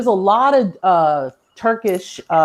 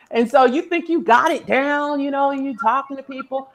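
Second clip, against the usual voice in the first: normal talking voice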